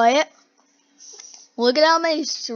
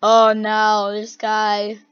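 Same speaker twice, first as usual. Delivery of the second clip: ordinary talk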